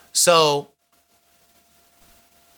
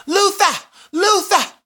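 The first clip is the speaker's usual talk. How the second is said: high, fluttery calling of his name; frantic boyfriend; 4 dB louder, slower than usual. Retold or imitated. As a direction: call him off in a panic, pitched way up. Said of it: "and he's like".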